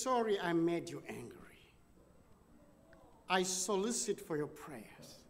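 near-whispered, timid apology; hushed apology. Retold or imitated. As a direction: murmur it as if afraid of having upset him. Woman apologises softly at desk.

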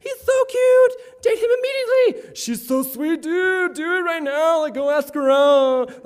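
high, loud, excited friend voices; pushy friends. Retold or imitated. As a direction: squeal it like hyped-up friends. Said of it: "what all your, like, friends say".

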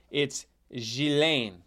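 GHISLAINE as zhee-LAYN, no S; silent S. Shown as a demonstration. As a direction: draw out GHISLAINE, French zh, S left silent. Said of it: "No, the S isn't pronounced".

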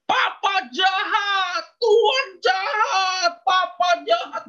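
jeritan tinggi, lambat, menangis; menjerit menangis. Retold or imitated. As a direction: teriakkan sambil terisak. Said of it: "Dia langsung teriak". (jerit tangis anak perempuan)

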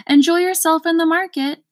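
shouted. A narrator's reading. Undersecretary calling to Milo while rushing off, loud. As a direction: call it back over the shoulder. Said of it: "shouted the undersecretary".

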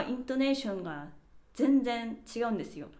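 ordinary delivery

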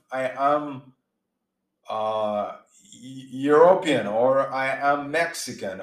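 normal talking voice

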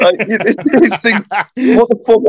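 amused disbelief, laughing. Real, not acted. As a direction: crack up while saying it. Laughing while retelling disbelief.

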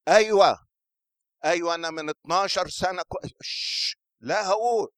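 high, loud eager outburst; excited testimony. Acted. Healed woman speaking before the men, excited. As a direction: burst out eagerly, unstoppable. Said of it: "وبتتكلم قدام الرجالة".

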